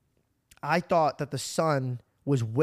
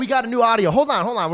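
loud excited "hold on", higher and louder; reining in the excitement with a shout; 7 semitones higher, 10 dB louder than usual. Real, not acted. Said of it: "we're getting too excited".

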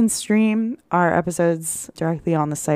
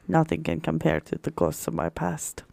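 sinister; 2 semitones lower, 12 dB quieter than usual. Pretend. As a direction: brood softly, as if haunted. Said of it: "Sounds even more sinister".

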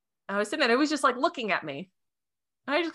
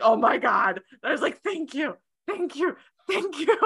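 choked up; 8 semitones higher than usual. Real, not acted. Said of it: "choking up right there".

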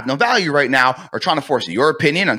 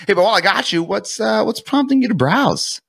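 high, lifted curious question; curious, stretched out at the end; curiosity tone; 7 semitones higher than usual. Shown as a demonstration. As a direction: ask as if casually wondering. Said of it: "Curiosity tone".

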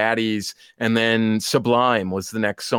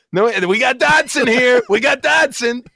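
higher, louder mock yell; mocking shout; 10 semitones higher, 5 dB louder than usual. Newman teasing Dodson in the movie scene, loud mock shout. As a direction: crow it out loud to tease him. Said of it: "and he's like".

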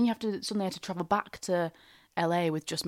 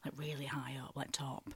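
softer, whispering the last word; hushed; 3 semitones lower, 14 dB quieter than usual. Real, not acted. Telling a friend about government contacts, hushed. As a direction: drop your voice like sharing a secret. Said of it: "hushed confidential emphasis".

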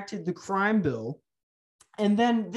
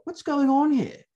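a higher, louder baffled anchor voice; mock news anchor; 6 semitones higher, 5 dB louder than usual. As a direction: play the clueless anchor asking. Mocking news anchor quote.

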